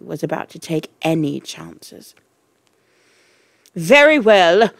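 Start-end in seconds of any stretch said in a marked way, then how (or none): 3.7-4.7 loud, raised headmistress boom. A narrator's reading. Trunchbull to the silent class, booming. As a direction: boom it out over the room. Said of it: "boomed the Trunchbull".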